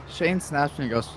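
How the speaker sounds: usual speaking voice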